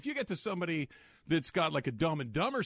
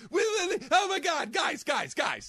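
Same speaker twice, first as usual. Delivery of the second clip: much higher, louder excited party woman; Candace impression; 10 semitones higher, 4 dB louder than usual. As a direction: gush like a woo girl at a party. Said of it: "That's my Candace".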